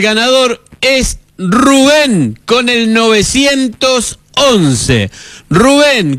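drawn-out winner reveal, rising high; excited announcement. Real, not acted. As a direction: build it up and call the winner. (anuncio entusiasmado del ganador)

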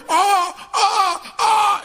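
high yelling cry of pain; pained scream. Retold or imitated. Patient screaming in the dentist's chair, high pained yell. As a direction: scream like the tooth is being pulled.

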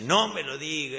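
the hidden voice finally losing patience; exasperated shout. Retold or imitated. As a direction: snap it out, sick of hearing it. Third time, priest loses patience.

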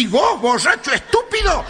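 high, indignant retort; indignant old woman. Acted. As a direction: snap back, offended and high-pitched. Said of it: "La vieja indignada le dice". (vieja indignada, con voz aguda)

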